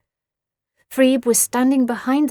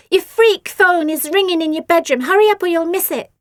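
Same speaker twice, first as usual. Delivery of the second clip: high, loud, hurried call; bratty sister calling; 8 semitones higher, 7 dB louder than usual. A narrator's reading. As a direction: shout it across the garden. Bratty sister calling across garden.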